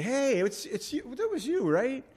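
high, delighted surprise at meeting someone; excited recognition. Retold or imitated. Preacher imagining meeting the prophet in heaven. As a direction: pitch jumps up in happy surprise. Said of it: "we're gonna be walking around".

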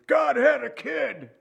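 high, slow mock yell; imitating the man shouting it at him; slow, high mocking shout of a stubborn visitor; retold yell. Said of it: "and he yells at me".

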